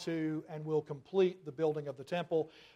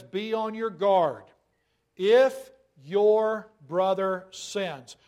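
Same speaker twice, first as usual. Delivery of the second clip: louder, slow and deliberate; slow, weighty scripture quote; 7 dB louder, much slower than usual. Acted. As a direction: lean hard on every word. Preacher intones Jesus' words.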